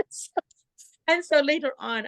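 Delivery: ordinary talk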